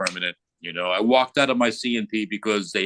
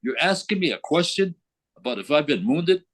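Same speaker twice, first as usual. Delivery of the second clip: high-pitched indignant comeback; worked up, stressing the admission; agitated; 6 semitones higher than usual. Retold or imitated. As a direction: reenact an irritated retort. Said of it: "You seem like to be a little bit agitated".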